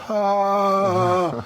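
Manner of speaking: high, sustained "ah" of overwhelm; high imitated wail, held out; mock tearful wail. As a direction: act out helpless weeping in one long high cry. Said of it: "tears start to ejaculate".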